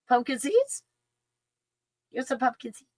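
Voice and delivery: high voice offering a treat; pet-talk coaxing. Real, not acted. Owner offering her dog pumpkin seeds, high coaxing.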